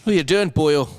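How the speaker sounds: punchy mock-Irish call; Irish accent. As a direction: put on a hammed-up Irish accent. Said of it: "I can do Irish".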